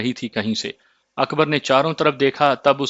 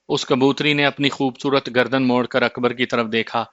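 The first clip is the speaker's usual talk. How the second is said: ordinary delivery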